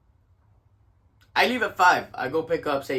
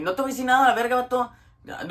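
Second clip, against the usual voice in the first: rapid, high-pitched angry comeback; acted-out heated refusal in quick-fire Spanish; pissed off; 7 semitones higher, 3 dB louder, faster than usual. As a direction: jump right back at the caller. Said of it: "I was pissed off already".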